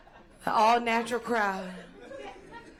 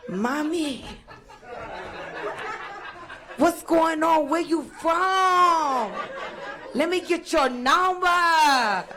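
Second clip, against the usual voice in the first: higher, louder, slow eager come-on; eager pickup guy; 8 semitones higher, 4 dB louder, much slower than usual. Retold or imitated. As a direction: chase her number like a smitten guy. Men hitting on her voiced.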